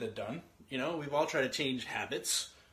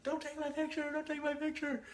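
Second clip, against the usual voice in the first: put-on high, whining child protest; whiny kid voice; 12 semitones higher, 6 dB quieter than usual. Son begging his brother not to photograph him, high whine. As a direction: plead like a small kid dodging the camera. Kids arguing over camera.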